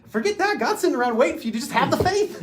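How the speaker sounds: high, loud, impassioned retort; emphatic rebuttal. Retold or imitated. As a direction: burst out to knock down the doubt. Acts out rebutting doubters about God's will.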